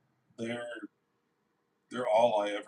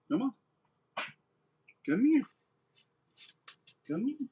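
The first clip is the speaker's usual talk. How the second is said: calling the dog; 13 semitones higher than usual. Real, not acted.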